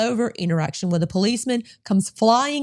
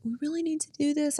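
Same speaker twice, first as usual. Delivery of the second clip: flustered pleading; 6 semitones higher, 9 dB quieter than usual. Retold or imitated. Acting out her pleading with cop.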